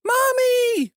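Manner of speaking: very high child's cry, acted out; high-pitched wail for mother; kid's mock scream. A narrator's reading. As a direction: squeal it out like a desperate kid. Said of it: "I hear a kid screaming".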